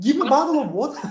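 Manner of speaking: high, loud, quick "bottle of water" with every syllable pronounced; exasperated correction. Retold or imitated. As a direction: correct them, sounding out both syllables of "water". Corrects the visitor's Welsh pronunciation.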